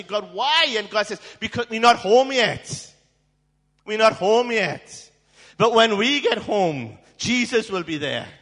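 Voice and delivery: loud, ringing preacher's cry; full-force preaching. Acted. Preacher proclaiming God's promise to the congregation, full-force. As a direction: preach it to the back row, loud.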